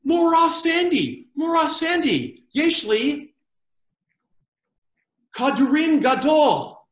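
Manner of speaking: high, loud, drawn-out schoolboy call; fifteen-year-old boy. Retold or imitated. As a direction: call out slowly to teacher, proudly childish. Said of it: "as a fifteen-year-old boy".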